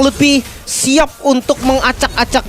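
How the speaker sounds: high, loud play-by-play; esports caster. Real, not acted. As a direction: call the team fight live. Live team fight call.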